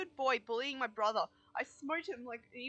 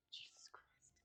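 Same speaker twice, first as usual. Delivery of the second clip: breathy whispered exclamation; 23 dB quieter, much slower than usual. Real, not acted. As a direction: whisper it under your breath. Startled by loud music.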